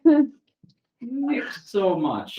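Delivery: put-on slow, drawn-out German-accented voice; heavy German accent, stretched out. Werewolf Wulfric answering in a slow German accent.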